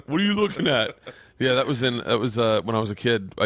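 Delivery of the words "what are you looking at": tough fish. Retold or imitated.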